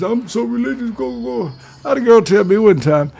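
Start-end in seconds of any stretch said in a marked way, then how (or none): 0.0-1.5 high, soft mocking voice of a fake-religious woman; mocking churchy woman. Mocking women who claim they're religious, high and soft. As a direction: sneer it out in a prissy high voice. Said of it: "they claim they so religious".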